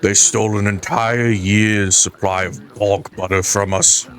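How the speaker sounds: usual speaking voice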